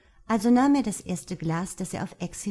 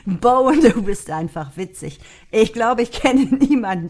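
loud, laughing through the words; 5 semitones higher, 12 dB louder than usual. Acted. Luke teases Mark through laughter, loud. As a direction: tease him while still laughing. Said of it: "hielt sich den Bauch vor Lachen".

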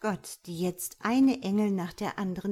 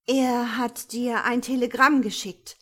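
higher, louder, anxious announcement; frightened; 4 semitones higher, 8 dB louder than usual. A narrator's reading. Petra hands angry God a telegram, frightened. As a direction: break the news with some fear. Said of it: "sagt sie verängstigt".

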